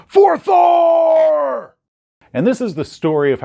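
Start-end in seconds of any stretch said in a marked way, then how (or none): 0.1-1.8 one long, high, loud war cry; triumphant scream. Acted. Barbarian standing in the smoking ruin, screaming in triumph. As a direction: scream it and hold the last word. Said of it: "he holds up his hammer and he screams".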